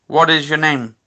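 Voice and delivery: calling out to spirits. Real, not acted. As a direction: ask an unseen spirit aloud. Questioning the spirit aloud.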